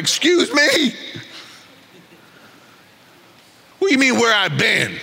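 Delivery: high, offended "excuse me"; indignant angel. Pretend. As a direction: huff at the question.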